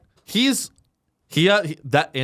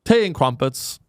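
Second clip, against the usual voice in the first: mock British accent. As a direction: put on a hammed-up British voice. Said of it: "it's all British guys".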